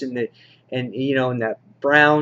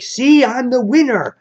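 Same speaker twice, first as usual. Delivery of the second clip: much higher, louder mock-villain gloat; 10 semitones higher, 6 dB louder than usual. Reviewer voices the Cedar crowing over his victory, hammed-up gloat. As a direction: crow over the win like a cartoon villain. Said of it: "And the Cedar's like".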